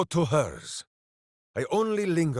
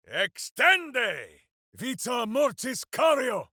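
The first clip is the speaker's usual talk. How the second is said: high, ringing chanted spell words; spell incantations; 10 semitones higher than usual. Acted. Minsk casting in battle, proclaiming incantations.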